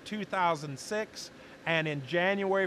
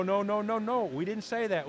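ordinary talk

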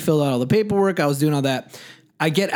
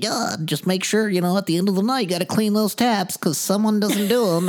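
higher-pitched mock beer-snob voice; put-on know-it-all beer bore, pitched up; beer snobby; 5 semitones higher than usual. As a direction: imitate the snobby manager giving instructions. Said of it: "did he all actually sound like that".

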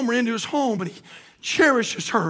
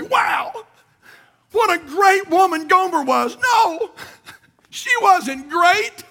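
full-force preaching; 5 semitones higher than usual. Acted. Pastor exalting the husband's mercy at full preach. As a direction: preach it to the rafters.

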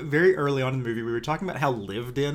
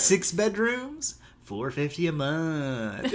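mock smug brag; slower than usual. Mocking George's bragging rent.

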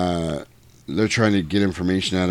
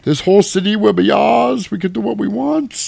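much higher, loud mock villain boast; villain quote; 12 semitones higher, 5 dB louder than usual. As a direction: ham up the villain's threat. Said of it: "he's talking in the trailer".

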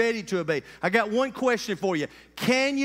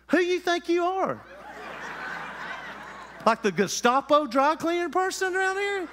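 higher, hammed-up indignant retort; mock angry outburst; 7 semitones higher than usual. Preacher retelling telling off the dry cleaner, mock indignant. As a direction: play the offended customer snapping back. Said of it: "I just went off on him".

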